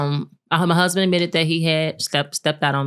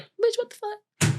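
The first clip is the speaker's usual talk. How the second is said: much higher, incredulous squeal; mock herself in outraged disbelief; exasperated disbelief; 5 dB quieter, slower than usual. Woman scolding her past self for caring, high incredulous squeal.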